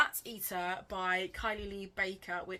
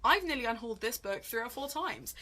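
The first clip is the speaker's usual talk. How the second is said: ordinary talk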